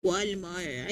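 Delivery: imitating a parent's accented Walmart; parents' accent. Quoting how parents say Walmart, parents' accent. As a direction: say it low, in a parent's Spanish accent. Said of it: "when your parents say".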